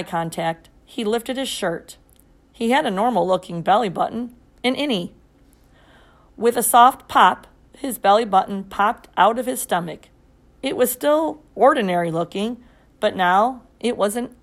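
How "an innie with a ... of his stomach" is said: ordinary delivery, nothing marked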